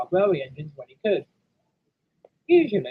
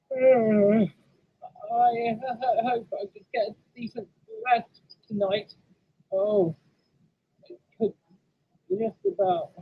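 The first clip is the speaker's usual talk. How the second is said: slightly raised, halting sleepy voice; tired and faltering, with drawn-out words; drowsy engine; 5 semitones higher, slower than usual. Acted. Turbo longs for a decent rest, drowsy.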